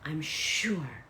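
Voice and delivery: great sarcasm. Acted. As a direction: dryly pretend to be certain. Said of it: "I say this with great sarcasm".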